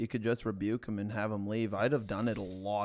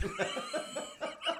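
high laugh; 7 dB quieter than usual. Real, not acted. Laughing at own ad bit.

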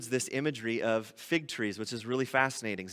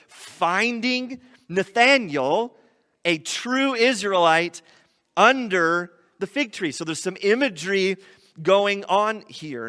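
higher, louder, slow preacher emphasis; slow, raised sermon climax; emphatic preaching; 5 semitones higher, 5 dB louder, slower than usual. Acted. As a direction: drive the point home from the pulpit. Preaching at full force.

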